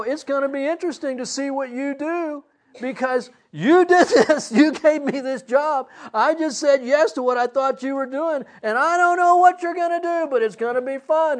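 high, loud, giddy address to God; retold laughing surrender. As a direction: hand it over with exuberant abandon. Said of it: "I just started laughing. I go".

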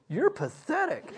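high-pitched mocking verdict; mock self-scorn. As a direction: scoff at your own blubbering self, playfully. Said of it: "I could see myself".

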